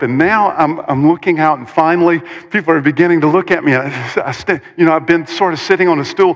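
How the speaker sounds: normal talking voice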